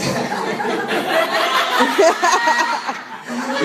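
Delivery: airy, high laughter; breathless laugh. Real, not acted. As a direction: let a breathless laugh out at your own joke. Laughs at own joke.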